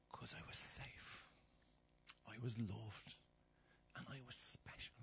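slow, soft, near-whispered confession; hushed. Real, not acted. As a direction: let it fall to a whisper. Quiet climax of sermon.

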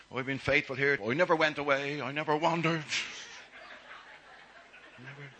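loud, indignant boasting; self-righteous. Acted. Older son boasting his faithfulness, indignant. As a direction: protest your own goodness loudly.

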